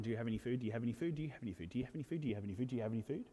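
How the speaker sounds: rapid-fire, low, soft string of questions; repeated asking. Acted. Disciples going up to everyone in the crowd, rapid repeated asking. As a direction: run the question on repeat, barely pausing. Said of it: "going up to everyone".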